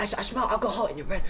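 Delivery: high-pitched imitation of an accuser; accusing stranger. Stranger accusing his neighbour of drinking, high mimicry.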